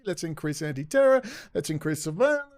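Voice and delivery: squeaky mock-alarm of the powers that be; mock officials. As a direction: parody scared officials, high and breathless. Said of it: "creating enemies and then going".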